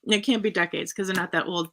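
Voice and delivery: ordinary delivery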